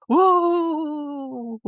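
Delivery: high cheering whoop; crowd cheer. Retold or imitated.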